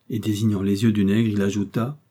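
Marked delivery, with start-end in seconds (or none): none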